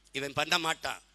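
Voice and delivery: normal talking voice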